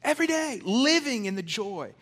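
joyful preacher's climax; rising joyful exhortation. Real, not acted. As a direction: lift them up with joy.

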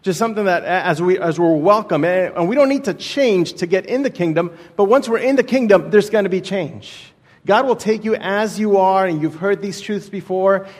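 usual speaking voice

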